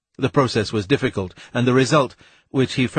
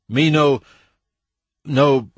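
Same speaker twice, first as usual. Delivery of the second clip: higher-pitched, halting false start; faltering, broken off; 4 semitones higher, 4 dB louder, slower than usual. A narrator's reading. Weston begins in Malacandrian then breaks off, halting attempt. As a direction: start in pidgin, pause, then give up. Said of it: "and then broke off".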